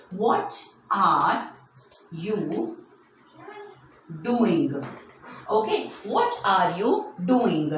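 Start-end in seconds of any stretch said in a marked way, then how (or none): 0.1-4.8 very slow question, broken by long pauses; word-by-word dictation. Shown as a demonstration. Teacher writing a question for children, slow word-by-word dictation.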